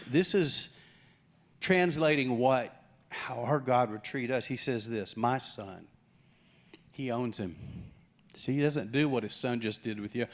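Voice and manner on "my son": tender father. Acted. Father tenderly claims son.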